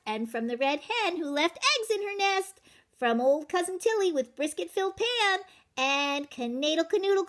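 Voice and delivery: high, bouncy boastful chant; sing-song brag as the runaway cracker; cocky matzah man. Acted. Matzah man taunting the baker in a high boastful sing-song.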